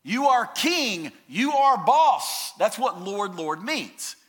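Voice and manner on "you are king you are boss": slow, loud, higher proclamation; emphatic declaration. Retold or imitated. Believers declaring Jesus king, slow loud proclamation. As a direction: proclaim it like a vow of allegiance. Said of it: "they are saying".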